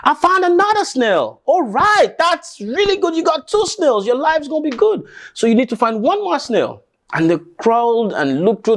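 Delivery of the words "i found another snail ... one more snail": excited calling. Acted. Calling across the farmland.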